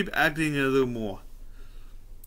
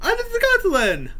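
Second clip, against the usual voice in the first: high squeaky shout; shrieking comic voice; 5 dB louder than usual. Retold or imitated. Jim Carrey impression squeal.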